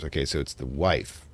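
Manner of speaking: ordinary talk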